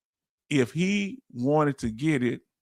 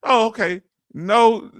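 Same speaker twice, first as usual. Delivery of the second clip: ordinary talk, nothing marked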